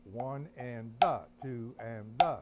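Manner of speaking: counting one and da with a punched da; accent on da. Shown as a demonstration. Teacher counting aloud with the accent on da. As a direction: stress the third syllable, da. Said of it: "shifting the accent to the third note".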